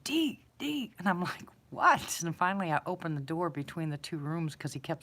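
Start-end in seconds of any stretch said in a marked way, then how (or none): 0.0-0.9 imitated urgent, higher-pitched call; high mock-anxious name call. Scared friend calling out at night. 1.7-2.2 higher, louder mock-exasperated reply; re-enacted impatient shout back; exasperated answer. Answering friend's repeated calls.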